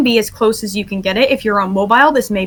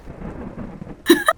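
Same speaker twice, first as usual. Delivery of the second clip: high breathless giggle; 5 dB quieter than usual. Real, not acted. Laughing at players' antics.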